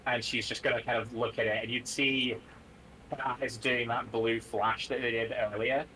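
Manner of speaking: ordinary talk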